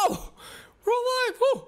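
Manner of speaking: high, slow drawn-out victory shout; we made it, shouted high; triumphant cry. Real, not acted. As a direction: stretch it out in relief. Surviving the obby section.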